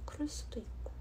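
hushed whispered aside. Real, not acted. Streamer musing to herself about dialect, whispering. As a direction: drop to a whisper. Soft aside after guessing.

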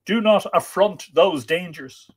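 dramatic narrated plea, starting high and loud; urgent warning that settles as it goes on; urging. A narrator's reading. Lover dissuading him from that drear journey, urging. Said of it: "she urged".

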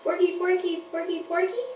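a lightly squeaky puppet ask; puppet question. Pretend. Puppet Peter asks Jesus, lightly squeaky. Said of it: "Peter asked him".

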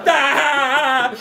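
high scream; mum's scream. A narrator's reading. Mum hearing spider could pounce: high shriek. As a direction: shriek in fright. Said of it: "Mum screamed even more".

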